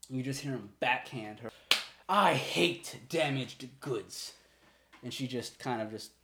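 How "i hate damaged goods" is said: drawn-out, louder, cold threat; slow menacing anger. Acted. Father to daughter after the slap, slow and menacing. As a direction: lean on every word, slow and heavy.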